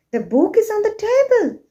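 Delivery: quick, high "the book is on the table", pitch up on TABLE; question, rising voice. Shown as a demonstration. Teacher repeating the question, "the book is on the table" with rising voice. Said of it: "I'm asking question".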